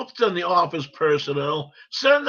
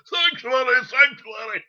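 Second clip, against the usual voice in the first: Quasimodo cry; 5 semitones higher than usual. Retold or imitated. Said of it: "Quasimodo down there on the border going".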